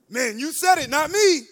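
loud retort, climbing to the end; emphatic retort. Retold or imitated. Boyfriend to pregnant girlfriend, loud emphatic retort.

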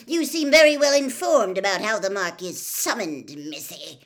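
loud, accusing sarcasm; mock the answer loudly, dropping at the end; scornful disbelief. A narrator's reading. Mister Crouch interrogates Hermione over the incantation, scornful disbelief. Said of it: "Disbelief etched all over his face".

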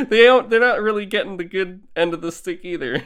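real laugh carried into the sentence. Real, not acted. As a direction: let the words bubble out on a laugh. Laughing at the mothers' sad fates.

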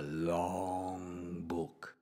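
drawn-out words fading into sleep; dozing off. A narrator's reading. Granddad reads a long book, slowing sleepily to nothing.

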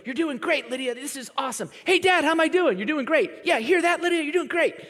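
put-on high, bright child's voice calling out praise; little girl cheering herself. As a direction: play a small girl on a bike shouting encouragement to herself. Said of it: "literally, she'll go".

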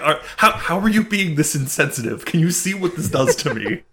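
playfully aggrieved outburst; mock indignation. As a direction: protest like you've been wounded, hammed up. Mock hurt guy protesting insensitivity.

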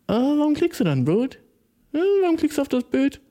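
high-pitched baffled questioning; exaggerated, high incredulity; mock incredulous. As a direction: demand an explanation in high-pitched disbelief. Mock-challenging a commenter in disbelief.

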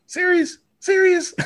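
high, loud disbelieving serious; incredulous. Retold or imitated. Cohost retells his reaction to the rumor, incredulous. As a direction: squeal it in disbelief. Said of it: "I'm like".

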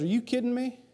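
put-on high, scornful giant taunting a boy; mocking Goliath. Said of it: "Goliath looks at David and goes".

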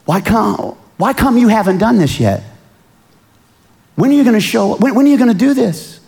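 impatient martyrs demanding justice; impatient pleading cry. Retold or imitated. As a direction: plead with God, rising and speeding up. Martyrs crying out in heaven.

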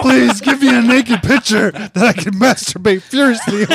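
creepy guy voice. Pretend. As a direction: overdo the leering creep. Continuing creepy voice bit.

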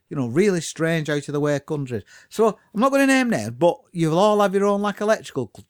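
ordinary delivery

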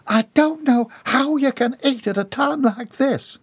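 high, distressed outcry. A narrator's reading. Bobby Sue upset at Jeb eating, high outcry. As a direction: cry it out in dismay, high. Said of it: "cried Bobby Sue".

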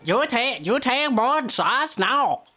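high, broken put-on Chinese accent; mock Chinese accent. Drinking game friend nagging about shots, fake Chinese accent. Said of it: "The man's Chinese".

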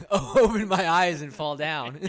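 loud slow laughing line. Real, not acted. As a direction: laugh it out, slowly. Laughing joke about falling.

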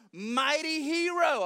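loud, high, drawn-out heroic call; angel's hail. Acted. Angel hailing a hiding Gideon as a hero, grand loud call.

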